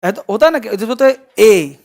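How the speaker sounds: normal talking voice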